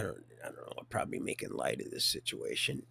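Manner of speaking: whispered aside; hushed, half-laughing aside. Real, not acted. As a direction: drop to a confiding whisper.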